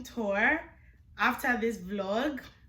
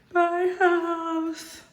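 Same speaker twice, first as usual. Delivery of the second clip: excited; 9 semitones higher, 4 dB louder than usual. Real, not acted. Repeats the farewell, high.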